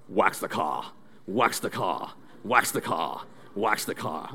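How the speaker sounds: low, soft sensei chant; hushed karate master's instruction; Mister Miyagi. Acted. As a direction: intone it quietly, dropping the r in car. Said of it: "then Mister Miyagi says".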